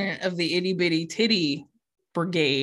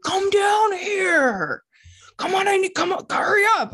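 impatient dad calling; 10 semitones higher than usual. Retold or imitated. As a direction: imitate dad hollering to hurry. Dad calling her downstairs.

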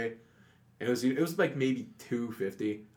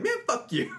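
very high, exaggerated voice tossing off a curse; mock casual swearing. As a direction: squeak it out like a careless adult. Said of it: "everyone's like".

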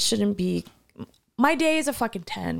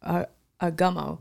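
ordinary talk

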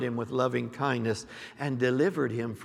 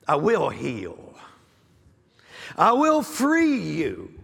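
prophetic declaring; 3 semitones higher than usual. Acted. Prophet to the church, stirring declaration. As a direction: proclaim each promise with force.

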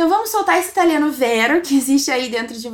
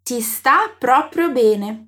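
slow, clearly spaced words; slow model phrase; 3 semitones lower, much slower than usual. Shown as a demonstration. Teacher shows a compliment in Italian, slowly. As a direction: lay each word out for the learner. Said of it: "Repete pra mim".

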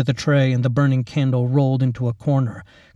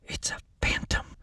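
hushed soft whisper; 11 dB quieter than usual. A narrator's reading. Lacey naming the monster, whispering. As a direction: breathe it in awe. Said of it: "Lacey whispered".

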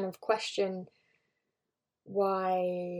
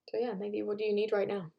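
ordinary talk, nothing marked

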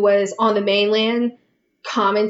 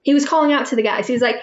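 usual speaking voice

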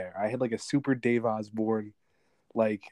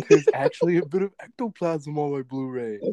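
laughing; 3 semitones higher, 4 dB louder than usual. Real, not acted. Reveals ectoplasm gag, laughing.

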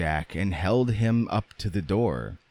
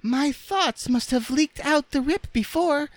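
very high, squeaky rag doll; Raggedy Ann's voice. A narrator's reading. Rag doll explaining to the others, squeaky. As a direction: speak in a small piping falsetto.

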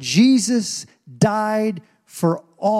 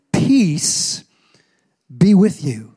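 louder, ringing declaration of peace; proclaimed blessing; 6 dB louder, much slower than usual. Acted. Preacher voicing Jesus greeting the frightened disciples, proclaiming. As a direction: pronounce it over the congregation.

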